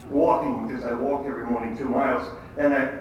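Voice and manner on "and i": usual speaking voice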